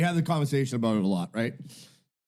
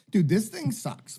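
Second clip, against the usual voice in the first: higher, slow fed-up complaint; exasperated; 9 semitones higher, slower than usual. Real, not acted. Ranting to friends about bad AI, exasperated. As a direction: drag it out, fed up.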